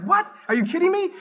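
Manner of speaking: high, loud astonished cry; shocked at his own epiphany; stunned disbelief. Retold or imitated. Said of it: "I was like".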